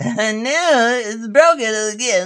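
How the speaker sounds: very high, hammed-up wail; mock wail. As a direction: whine in exaggerated despair, voice way up high. Mock despair at broken player.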